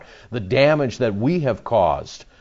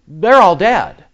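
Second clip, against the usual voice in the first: preaching at full force; 8 semitones higher, 8 dB louder, slower than usual. Real, not acted. Preacher's climax on the slaughter.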